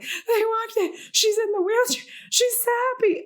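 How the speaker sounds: very high, squeaky mock weeping; hammed-up happy sobbing through the words; imitating crying. Customer watching people learn they got hired, imitated crying. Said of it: "I cry every time".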